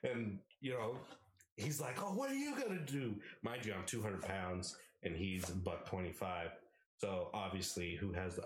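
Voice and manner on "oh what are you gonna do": high challenging taunt. Retold or imitated. Roommate taunting him to fight, high taunt. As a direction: dare him to act.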